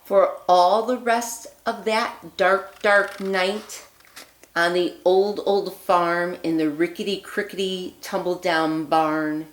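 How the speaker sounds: put-on lively read-aloud voice for children; storybook reading. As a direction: read it like a bedtime story for little ones. Reads picture book aloud.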